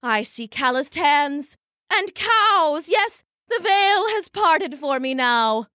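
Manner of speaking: high, slow theatrical fortune teller; grand carnival seer voice; mystic soothsayer. Acted. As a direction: proclaim it like a stage psychic.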